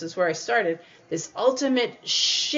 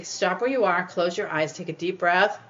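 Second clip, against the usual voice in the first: ordinary talk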